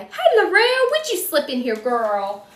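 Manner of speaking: loud, high, happy call out to a friend; light up and sing out her name; excited greeting. Real, not acted. Host greets a friend who joined the live stream, excited.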